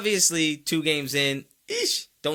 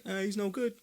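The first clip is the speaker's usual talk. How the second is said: mock doubter; 8 semitones higher, 5 dB quieter than usual. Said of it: "watching him saying".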